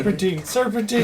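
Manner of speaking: high, loud comic shout; playful high-pitched yelling; urgent mock call. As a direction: holler it like a frantic warning. Calls out a joking warning.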